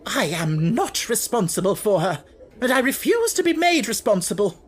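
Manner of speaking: high, loud refusal that climbs; rising rage. Acted. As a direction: refuse it, fury building.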